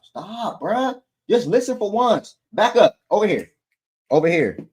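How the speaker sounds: high, loud exasperated scolding; fed-up owner yelling at the dog. Real, not acted. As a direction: lose patience with the dog.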